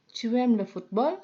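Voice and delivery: "Tu aimes le football?" asked with a clear rising question tune; rising intonation. Shown as a demonstration. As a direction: lift the voice toward "football" to make it a question. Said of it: "dengan cara menaikkan intonasi". (intonasi naik di akhir pada "football")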